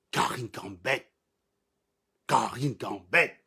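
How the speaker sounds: slow, drawn-out name, pitched low; mocking incredulity. Real, not acted. Streamer mocks someone's taste in Karine Gambette, slow and incredulous. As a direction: stretch each syllable in disbelief.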